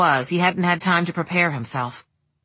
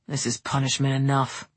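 grumbling; 4 semitones lower, 4 dB quieter than usual. Acted. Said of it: "Kevin grumbled".

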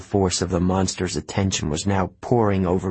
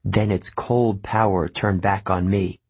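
ordinary delivery

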